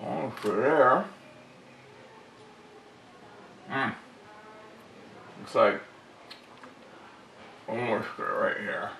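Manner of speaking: talking around a flashlight held in teeth; flashlight in mouth. Real, not acted. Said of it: "let me take this out of my mouth".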